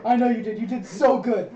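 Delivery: loud, high doting praise; hammed-up proud encouragement; gushing praise. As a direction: praise them like an eager puppy. Praising the devoted knight.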